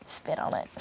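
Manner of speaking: put-on breathy whispered old lady; whispered granny voice; Granny's whisper. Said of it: "And then you have Granny over here just".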